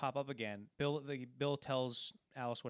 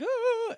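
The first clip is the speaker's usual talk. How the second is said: high, loud mock shriek; sudden high-pitched "ah"; imitated scream; 13 dB louder than usual. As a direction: shriek like someone caught by surprise. Imitates startled shopkeeper.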